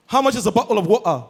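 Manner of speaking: quick London glottal-stop speech; put-on London accent. Returnee asking the price, put-on London accent. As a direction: drop the t in "bottle" and "water" London-style. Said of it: "The only thing he came with, accent".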